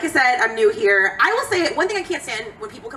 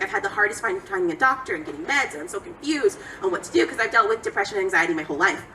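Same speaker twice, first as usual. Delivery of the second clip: ordinary talk, nothing marked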